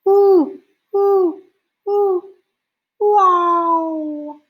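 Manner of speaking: high, loud hooting owl call; barred owl hoot. Retold or imitated. Showing kids the barred owl's call, high hooting. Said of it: "and it goes".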